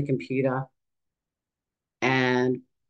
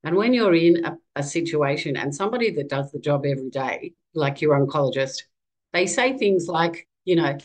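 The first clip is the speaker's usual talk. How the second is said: ordinary talk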